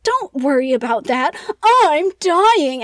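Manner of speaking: hammed-up mock dying; so dramatic. Eric dodging the Persian's questions, so dramatic. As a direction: brush it off, then wail it loud and high. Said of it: "like, so dramatic".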